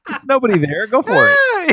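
high laughing go-for-it; laughing shout. Real, not acted. Laughing joke to empty chat.

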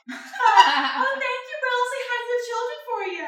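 high, light playful reply; hooker voice. Acted. A hooker answers Will in a high, flirty voice.

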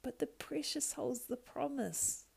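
whiny creature objection; Schmiegel objecting. Acted. Schmiegel objecting to his other self, whining. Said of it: "the voice of Schmiegel objected".

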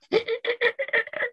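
high wheezy cartoon snicker; badly imitated Muttley laugh. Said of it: "That was the worst Muttley impression ever".

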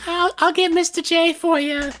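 squeaky high Harley Quinn voice; sing-song henchgirl fetching her boss. Acted. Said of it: "Harley Quinn is back".

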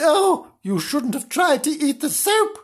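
high, wailing mock sympathy; exaggerated high-pitched scolding coo; Mistress voice. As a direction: fuss over the dog in a high voice.

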